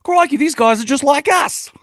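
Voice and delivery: high, loud mock Australian twang; bad Australian accent. Joking about Aussies to his podcast co-host, bad Australian accent. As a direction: ham up a terrible Australian accent, high-pitched. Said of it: "That's the worst accent ever".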